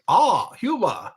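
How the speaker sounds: loud, hammed-up German accent on "Ah, humor"; mock German accent. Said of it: "he would say it with that German accent".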